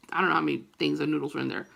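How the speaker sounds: ordinary talk, nothing marked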